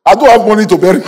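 high, loud imitated lament; hammed-up cry over money; mock wailing. Pastor mimics woman confessing her reason, loud high mock wail.

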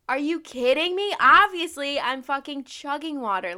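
loud, high, incredulous comeback; exasperated retort. Retold or imitated. Vlogger answering water advice, mock-outraged exasperation. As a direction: fire back loudly, drawing out the last words.

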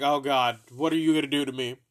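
loud, fast mock panic; hammed-up fear of what comes next; asking the dealer mom what she'll do, in mock panic. Nervous reply to dealer mom.

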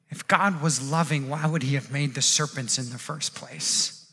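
low, soft, stubborn doubt; acted sceptic, muted and unmoved; quiet refusal. Bitten man refuses to believe the snake, quiet refusal.

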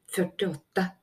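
plain reading voice

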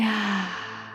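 empty the lungs in a releasing sigh; big sigh. Shown as a demonstration. Guide on the last round of tensing and releasing, big sigh. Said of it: "Let out a big sigh".

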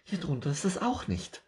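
high, bright squirrel voice rising into alarm; a fussy little squirrel who suddenly misses a leaf; excitable squirrel. Acted. Squirrel searching.

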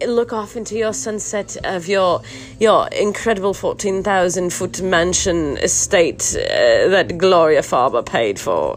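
put-on British director voice; British accent. Podcaster mimicking crew posing the man by his mansion, British accent. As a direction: direct the shot in mock British tones. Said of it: "I don't know why it turns into British".

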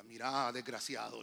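put-on a touch of an angry old grandmother; grandmother scolding. Grandmother telling off her drunk son, lightly voiced scold. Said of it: "le dijo mi abuelita".

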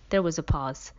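ordinary delivery

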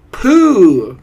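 high, very loud pooh; crowd's loud revolted hoot; disgusted cry. A narrator's reading. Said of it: "cried the audience".

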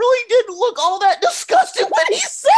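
loud, high-pitched laughing shriek; laughing outburst. Real, not acted. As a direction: squeal it out through laughter. Laughing outburst over the film scene.

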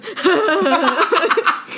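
mocking imitation of a friend's laugh; exaggerated, put-on guffaw; imitated laugh. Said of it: "You laugh like this".

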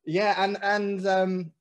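ordinary delivery

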